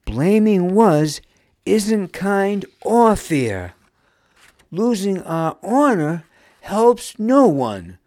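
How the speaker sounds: loud, high indignant rebuke; firm, upset. A narrator's reading. Nam upset, defends Wuz from blame, firmly. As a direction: put your foot down in their defence. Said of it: "she firmly stated".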